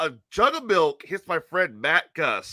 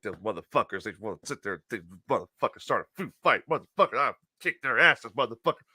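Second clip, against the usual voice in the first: low, soft muttered tirade; grumbled cursing; 8 semitones lower, 4 dB quieter, faster than usual. Retold or imitated. Storyteller mimics friend led away, grumbling curses. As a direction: mutter curses under your breath.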